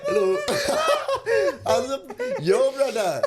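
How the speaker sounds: very high, loud laughing put-on Bali accent; hammed-up Bali accent lost in giggles; mock Bali accent. Shown as a demonstration. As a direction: squeal it out in a silly Bali accent while laughing. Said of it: "this is what I was saying".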